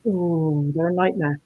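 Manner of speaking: ordinary talk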